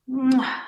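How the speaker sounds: a loud smacking kiss. Acted. Elena kisses Astrov goodbye, loud smacking kiss.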